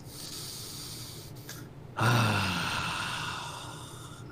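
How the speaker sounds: long breathy sigh before speaking; heavy sigh. Acted. Officer sighs at the player before speaking. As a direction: sigh out wearily. GM voices NPC sighing before speech.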